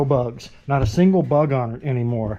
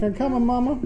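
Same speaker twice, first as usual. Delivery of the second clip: voicing the dog; 10 semitones higher, faster than usual.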